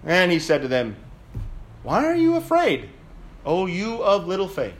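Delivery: dramatic Gospel reading, swelling with the storm; preacher reading the storm at sea; scripture reading. Acted. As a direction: read the Matthew passage with drama.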